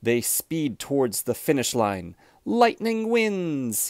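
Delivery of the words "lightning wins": higher, slow, thrilled announcement; excited win. A narrator's reading. Narrator cheers Lightning's win for young listeners, thrilled. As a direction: ring out the victory for the kids.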